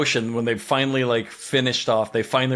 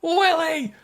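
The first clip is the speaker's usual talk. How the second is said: high, frantic calls of a name; hammed-up shrieking for a lost friend; mock panicked shrieking. Friends freaking out in retelling.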